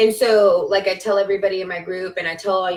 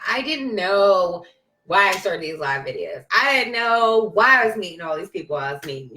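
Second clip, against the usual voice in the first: ordinary delivery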